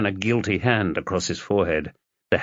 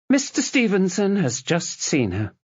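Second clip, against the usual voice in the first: nurse voice; 10 semitones higher than usual. Acted.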